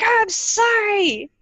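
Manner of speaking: high, drawn-out mock yell; reenacted yell. Retelling yelling at War Eagle yesterday, high drawn-out yell. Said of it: "yelling at War Eagle yesterday".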